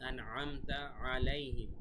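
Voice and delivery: "আন'আমতা আলাইহিম" slow, each ayn from the throat; careful ayn. Shown as a demonstration. Teacher modelling the letter ayn, careful "আন'আমতা আলাইহিম". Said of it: "আইনের উচ্চারণ আপনারা ঠিক রাখবেন".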